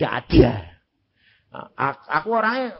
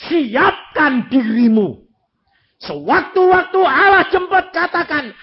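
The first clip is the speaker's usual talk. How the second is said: forceful preaching; 10 semitones higher, 6 dB louder than usual. Real, not acted. Preacher exhorting congregation to readiness.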